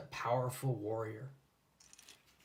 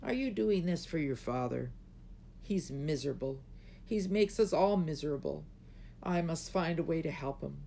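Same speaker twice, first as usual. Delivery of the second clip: tearful; 5 semitones higher than usual. A narrator's reading. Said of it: "Her eyes filled with tears".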